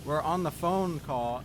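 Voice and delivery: ordinary talk, nothing marked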